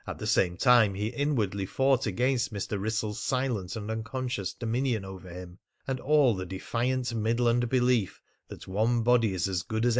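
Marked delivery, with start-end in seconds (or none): none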